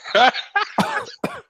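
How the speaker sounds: a high, squeaky giggle. Real, not acted. Guest giggling at his own money joke. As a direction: let a high laugh slip out. After joking about going negative.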